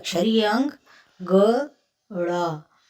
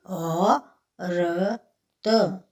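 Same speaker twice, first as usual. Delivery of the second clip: stretched word; much slower than usual. Shown as a demonstration.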